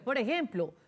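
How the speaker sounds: ordinary talk, nothing marked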